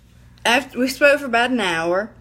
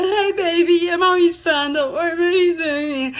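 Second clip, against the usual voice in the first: high, louder mock-breezy voice; put-on reassurance; 8 semitones higher, 3 dB louder than usual. Woman imitating herself reassuring her sweetheart, high put-on voice. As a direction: imitate yourself insisting all is well, pitched up. Retold act of being fine.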